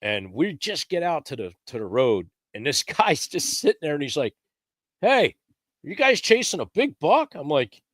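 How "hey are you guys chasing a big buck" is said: calling over. Retold or imitated. Stranger calling from the road.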